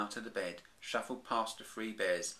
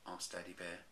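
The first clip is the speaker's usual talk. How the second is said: usual speaking voice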